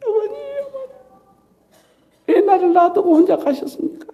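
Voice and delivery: high tearful cry to the sky; crying out to parents. Retold or imitated. Lonely schoolboy crying toward Seoul sky, tearful calling. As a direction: call out like an abandoned child weeping. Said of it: "하늘을 보면서 막 우는 거예요".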